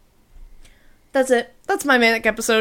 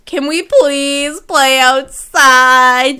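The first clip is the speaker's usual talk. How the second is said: put-on begging kids; 6 dB louder, much slower than usual. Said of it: "the kids were like".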